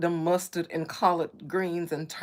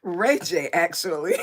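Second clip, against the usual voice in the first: breaking into laughter; 6 dB louder than usual. Real, not acted. Streamer returning to [name], breaking into laughter. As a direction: try to resume, then crack up. Tries to resume topic, cracks up.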